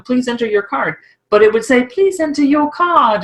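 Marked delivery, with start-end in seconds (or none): 1.9-3.2 slow, higher mock British ATM voice; imitating a posh British ATM; British accent. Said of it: "speak with a British accent".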